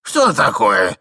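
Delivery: громкий вскрик встревоженного старичка; вскрикни громко, как переполошившийся старик. A narrator's reading. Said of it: "закричал старичок". (громко, испуганно)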